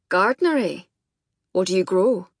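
put-on loud, gruff Scottish accent. A narrator's reading. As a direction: quiz her in a gruff Scots voice. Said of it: "Mac's gruff Scottish voice".

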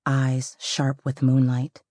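usual speaking voice

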